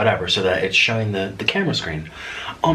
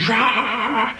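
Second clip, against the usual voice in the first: high, drawn-out mock shriek; imitated shrieking; 14 semitones higher than usual. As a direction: shriek as if shaking. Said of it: "shaking and shrieking".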